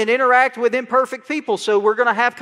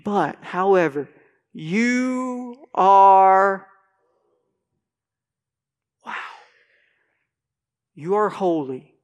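reverent reading; 5 semitones lower, much slower than usual. Acted.

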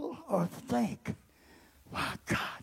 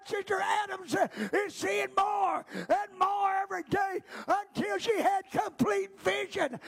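preaching at full force; 5 semitones higher than usual. Acted. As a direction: preach it out to the church.

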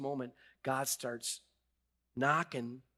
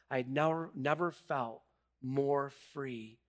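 normal talking voice

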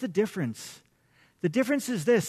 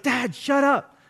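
higher, mock-annoyed retort; exasperated kid; 5 semitones higher, 4 dB louder, slower than usual. Kid to screaming dad, mock exasperation. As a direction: imitate a fed-up kid.

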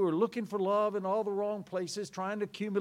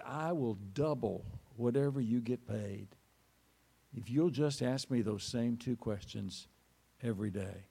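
softer, lower, wondering tone; quiet wonder; 6 semitones lower than usual. Acted. Rabbi offering the soldier a job, soft wondering voice. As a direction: say it gently, as if you just found something precious. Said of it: "look of somebody who had just discovered something".

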